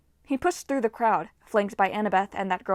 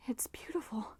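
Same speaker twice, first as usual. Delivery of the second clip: soft breathless whisper; awed whisper; 3 semitones higher, 12 dB quieter than usual. A narrator's reading. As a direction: whisper it in awe. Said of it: "Piper muttered".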